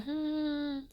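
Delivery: a short high soft hum, testing the sound. Shown as a demonstration. Speaker testing whether the moaning is her own, soft high hum. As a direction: hum once, checking if the voice is your own. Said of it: "make the sound too to see if it's really mine".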